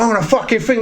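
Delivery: high-pitched mock threat; heated retelling, not shouted; retold threat. Retold quarrel before a fight.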